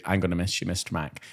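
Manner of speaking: softened, quiet little goodbye; a child's sweet quiet farewell; soft mock tender child. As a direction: imitate a child saying it sweetly and softly. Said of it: "it went from".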